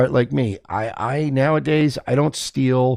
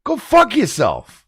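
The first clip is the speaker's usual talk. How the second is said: indignant innocent; 11 semitones higher than usual. Retold or imitated. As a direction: refuse like a wronged man. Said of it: "And you go".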